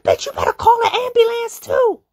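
high, loud screech; reenacted screaming threat. Retold threat in fight.

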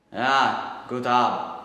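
loud, offhand brush-off; contemptuous loud dismissal; loud dismissive German. Acted. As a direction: wave the boy away. SS man dismisses boy.

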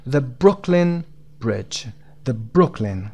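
"Brooklyn" with a strong first syllable, "Brook"; teacher modelling "Brooklyn", stress on "Brook". Shown as a demonstration. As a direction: hit "Brook" in "Brooklyn", then pause before "Bridge". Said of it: "قسمت بروک".

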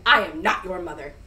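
grumpy bear scolding goslings; stern grumpy bear. Acted. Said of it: "Bruce was very stern".

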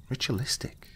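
Ritualistic, rising high and soft in disbelief; incredulous question. A narrator's reading. Young officer echoing the investigator in disbelief, soft rising echo. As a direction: echo the word back in shock. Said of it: "the officer asked".

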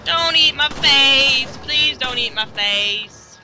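loud, drawn-out cry for mercy; mock pleading. Player begging a game monster, mock pleading. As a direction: beg them loudly, stretching the words.

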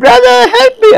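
high desperate scream; mock terrified scream; screaming for help. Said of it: "He's screaming".

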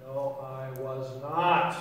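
low, slow, drawn-out denial. Acted. Peter answers the accusing woman, slow low denial. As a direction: deny it slowly, stretching each word.

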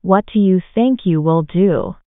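usual speaking voice

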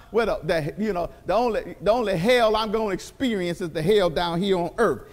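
lightly mocking the hell-on-earth crowd; mock complainer. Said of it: "people talking about".

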